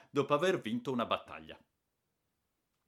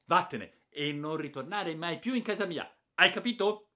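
much higher and louder, throwing him out; furious aunt; 9 semitones higher, 9 dB louder than usual. A narrator's reading. Aunt banishing the boy from her house, furious shout. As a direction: order him out for good.

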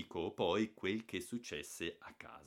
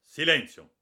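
much louder stern order; stern command; 7 semitones higher, 16 dB louder than usual. A narrator's reading. Father silencing the quarrel, stern loud command. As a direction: silence them both sternly. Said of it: "con voce severa".

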